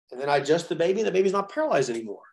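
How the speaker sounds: ordinary talk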